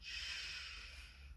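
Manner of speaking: soft unvoiced shush. Real, not acted. Dad shushing his chatty child, unvoiced shush.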